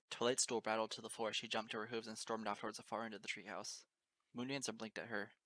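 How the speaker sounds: ordinary delivery, nothing marked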